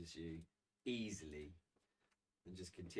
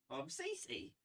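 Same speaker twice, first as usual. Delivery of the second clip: put-on high, eerie little-girl voice; creepy child voice; slower than usual. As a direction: play the creepy child, pitched way up.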